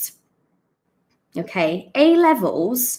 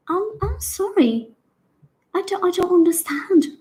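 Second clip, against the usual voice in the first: high, hesitant, apologetic voice; puzzled British lady; 9 semitones higher, faster than usual. Retold or imitated. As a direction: play a lady genuinely lost, pitched way up. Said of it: "She's genuinely, she doesn't get it".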